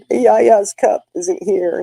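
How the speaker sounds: put-on slow, drawn-out little-girl complaint; grandma mimicking her granddaughter's pouting complaint; mock child whine.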